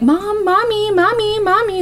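put-on high, drawn-out whining child calling mom; whiny kid voice. A kid nagging her mom, whiny and high. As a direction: whine like a clingy little kid. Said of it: "she's getting annoyed because Faith keeps like".

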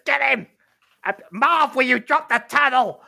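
high-pitched troll voice; troll giving orders. Acted. Blue troll ordering Marv to drop the turtle, bossy. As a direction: boss the dim sidekick about. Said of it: "the blue troll, having said".

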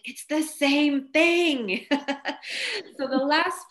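slow, laughing through the words. Retold or imitated. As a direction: laugh it out slowly. Laughing retelling of job frustration.

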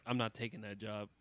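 low, soft, offhand refusal; cool refusal. Retold or imitated. Mobster recruit turning down the job, low cool refusal. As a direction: brush it off like a mobster. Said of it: "I'd be like".

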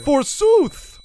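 loud, high theatrical outburst; hammy stage actor. Pretend. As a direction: shout it like an old stage ham. Mock Shakespeare reaction.

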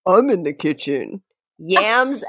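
ordinary delivery

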